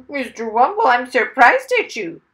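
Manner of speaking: loud, high parrot scolding; reproving parrot. A narrator's reading. Parrot shocked at Mister Wumble's plans, loud reproof. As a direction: squawk a shocked telling-off.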